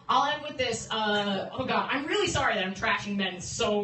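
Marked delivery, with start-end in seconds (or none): none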